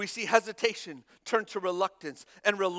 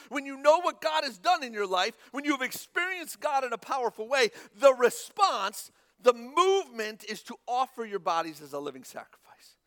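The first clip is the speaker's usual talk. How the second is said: ordinary delivery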